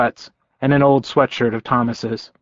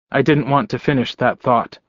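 usual speaking voice

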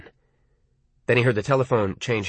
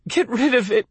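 pleading; 9 semitones higher than usual. Acted. Lee begging Arnie.

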